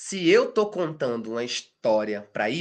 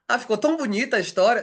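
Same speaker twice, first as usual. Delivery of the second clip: gushing praise; 8 semitones higher, 5 dB louder, faster than usual. Retold or imitated. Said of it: "com essa".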